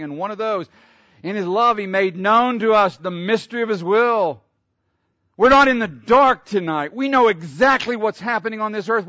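loud, high fervent preaching; preaching at full force. Acted. Preacher stirring the church at full force. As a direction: lift the congregation with rising fervor.

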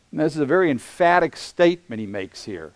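normal talking voice

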